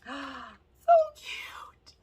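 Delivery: airy, high whispered squeal; awestruck squeak. Real, not acted. Seeing another cute palette, awestruck whispery squeal. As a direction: voice thinned to a whisper with delight.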